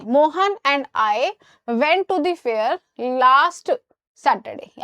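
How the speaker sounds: very slow, spaced words; slow corrected sentence. Shown as a demonstration. Teacher slowly reads the corrected sentence to students. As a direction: dictate the fixed version slowly. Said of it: "अब सही हो जाएगा".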